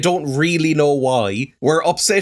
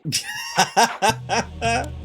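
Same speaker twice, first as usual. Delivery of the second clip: high, drawn-out giggle of delight; high amused cackle; amused laugh; 9 semitones higher, 3 dB quieter than usual. Real, not acted. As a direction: laugh helplessly at the hammy villain. Laughing at the villain's gory speech.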